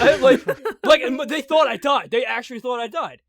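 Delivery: high, loud, through real laughter; laughing disbelief. Real, not acted. Storyteller to friends on being thought dead, laughing. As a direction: laugh while insisting on it.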